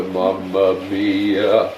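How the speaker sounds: slow mock Italian mamma mia; Italian accent. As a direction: ham it up like an Italian chef. Italian exclamation over pizza.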